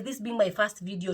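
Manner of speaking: ordinary talk, nothing marked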